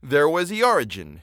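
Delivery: usual speaking voice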